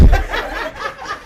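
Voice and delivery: high breathy laugh; airy laugh. Real, not acted. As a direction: let out a breathy chuckle. Laughing at himself.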